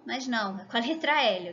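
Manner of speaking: ordinary talk, nothing marked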